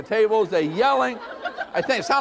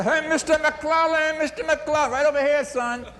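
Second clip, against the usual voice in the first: put-on pizza kid calling out; 9 semitones higher than usual. Delivery kid calls out to the boss across the restaurant, higher calling voice. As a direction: shout across the room for the customer.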